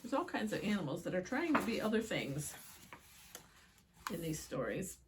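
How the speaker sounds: ordinary delivery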